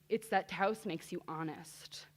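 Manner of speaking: usual speaking voice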